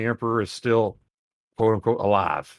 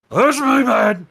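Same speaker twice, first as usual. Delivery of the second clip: much higher, louder squeak; orc voice; 13 semitones higher, 7 dB louder, slower than usual. Pretend. Orc claims the grenade, high squeaky orc voice.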